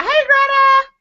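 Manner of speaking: high, bright hello to a friend joining; squealed welcome; excited greeting. Real, not acted. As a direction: call out her name with delight. Greeting guest popping on.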